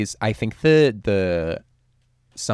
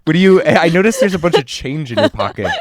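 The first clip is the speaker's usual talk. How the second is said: louder and higher, laughing hustler voice; New York hustler pitching help to a stranger, hammed-up; mock pushy New York hustler; 8 semitones higher, 11 dB louder than usual. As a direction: oversell help like a street scammer. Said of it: "So they'd be like".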